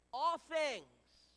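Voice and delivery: emphatic group recitation; congregational call. Real, not acted. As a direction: lead the church in saying it together. Congregation repeats one more time.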